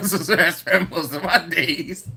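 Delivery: words breaking into laughter; laughing. Real, not acted. Streamer reading Sean's joke to viewers, laughing. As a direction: read it while cracking up.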